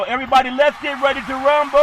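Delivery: announcer catchphrase. Retold or imitated. Host rallies listeners with a lightly voiced ring announcer call. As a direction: hype it like a boxing announcer. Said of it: "ring announcer catchphrase".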